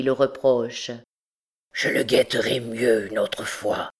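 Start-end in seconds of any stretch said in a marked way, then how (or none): 1.7-3.9 low, chastened promise; bowed and submissive. A narrator's reading. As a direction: accept the blame with head hung. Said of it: "dit-il en baissant la tête".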